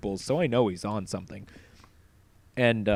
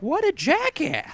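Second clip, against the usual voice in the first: scoffing; 3 dB louder, slower than usual. Retold or imitated. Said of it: "I'm like".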